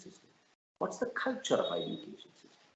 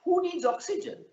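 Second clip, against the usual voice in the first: mocking politician. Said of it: "And he said".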